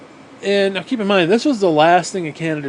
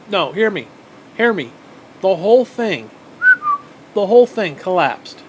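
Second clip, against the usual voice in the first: emphatic; 4 semitones higher, 5 dB louder than usual. Real, not acted. Insisting on the collapse.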